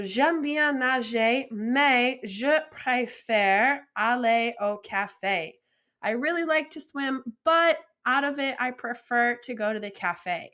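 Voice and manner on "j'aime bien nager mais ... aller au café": slow, even French reading; slow model sentence. Shown as a demonstration. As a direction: read it back slowly and clearly.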